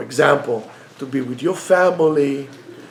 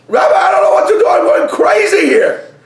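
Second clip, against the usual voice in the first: desperate plea; 6 dB louder than usual. Acted. Couple loudly begging the rabbi, high and frantic. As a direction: beg the rabbi, going out of your mind.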